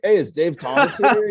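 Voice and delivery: a hint of a naive customer; clueless customer. Absurd Wendy's customer bit.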